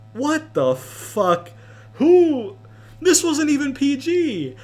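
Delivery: high, incredulous cry; shocked disbelief. Real, not acted. Outraged at move name.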